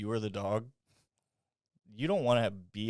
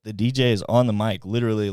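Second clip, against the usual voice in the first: louder retelling; 8 dB louder than usual. Retold or imitated. Repeated louder.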